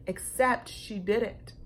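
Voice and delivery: ordinary delivery, nothing marked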